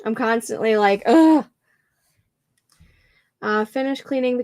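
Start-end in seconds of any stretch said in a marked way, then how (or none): 1.1-1.5 loud, high exasperated groan; sudden groan of frustration; frustrated ugh. Retold or imitated. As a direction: let out a fed-up ugh. Typing frustration, groan sound.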